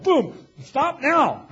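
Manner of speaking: loud, slow, drawn-out command; dad's sharp stop. Retold or imitated. Dad cutting off his son's slang, loud slow stop. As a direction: slam the brakes on him like a stern dad. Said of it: "My dad's like".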